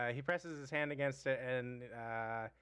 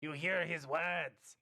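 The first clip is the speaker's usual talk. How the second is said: goblin voice. Acted.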